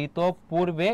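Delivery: ইতপূর্বে slowly, as "itop-purbe" with doubled p; pronunciation demo. Shown as a demonstration. Teacher models visarga for students, ইতপূর্বে slowly with doubled p. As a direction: sound out ইতপূর্বে in two parts, holding the p. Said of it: "এটা হচ্ছে তোমার উচ্চারণ".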